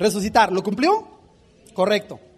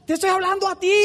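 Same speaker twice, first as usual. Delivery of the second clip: calling out; 6 semitones higher, faster than usual. Pretend. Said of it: "a gritos, prácticamente".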